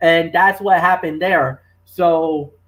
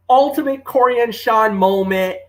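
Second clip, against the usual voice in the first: ordinary talk, nothing marked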